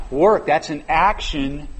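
"Work" slow and emphatic; stress on work. Shown as a demonstration. Preacher to congregation, stressing work slowly. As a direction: hit "Work" hard, drawn out. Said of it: "put the emphasis on different words here".

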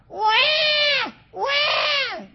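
high, loud mock baby wail; imitated baby cry. Preacher imitating a baby's huge cry, high wail. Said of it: "They have this huge cry".

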